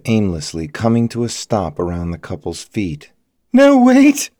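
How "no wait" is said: very high, loud panicked scream; terrified cry. A narrator's reading. Elizabeth as grenades land at her feet, terrified scream. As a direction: cry out in sheer terror. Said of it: "Elizabeth cried, her body shaking in terror".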